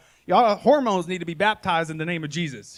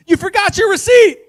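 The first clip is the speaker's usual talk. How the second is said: much higher, much louder yell; yelled after him; 16 dB louder than usual. Retold or imitated. Dad yells after his fleeing son, loud holler. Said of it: "I was just like".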